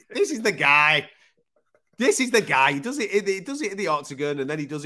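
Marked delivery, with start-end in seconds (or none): 0.1-1.1 slow, loud, emphatic declaration; excited praise. Retold or imitated. Host hyping up Derek Lewis, slow and loud. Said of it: "I'm like". 2.0-2.7 higher, loud excited cry; excited praise. Retold or imitated. Host hyping up Derek Lewis, higher and loud. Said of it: "I'm like".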